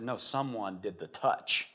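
slow, low, soft reply; quiet insistence. Acted. Jesus answering his disciples in the crowd, quietly insistent. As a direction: say it softly and deliberately, holding firm.

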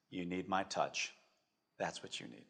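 low, very soft statement; gentle assurance. Acted. God telling people what they need, gentle and hushed. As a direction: let each phrase settle quietly.

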